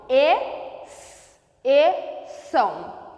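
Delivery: "exceção" broken into "E, e, são" with long pauses, XC as one S sound; syllable by syllable. Shown as a demonstration. Teacher showing X and C make one sound in "exceção", syllable by syllable. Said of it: "um só som".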